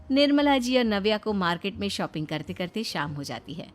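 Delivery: ordinary delivery, nothing marked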